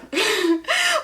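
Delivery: high bright laugh breaking out; short real laugh; burst of laughter. Real, not acted. Laughing recalling nursing school blur.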